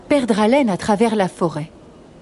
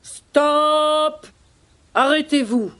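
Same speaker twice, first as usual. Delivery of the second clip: high, sustained commanding cry; roaring lion; 6 semitones higher, slower than usual. Acted. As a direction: halt the herd with a long lion's call. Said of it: "rugit de toutes ses forces".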